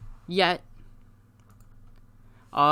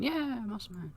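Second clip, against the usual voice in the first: tiny, high faraway fan cheer; very soft pretend crowd yell; mock cheer; 7 semitones higher, 14 dB quieter than usual. Lone fan cheering Muscleman, hushed high mock cheer.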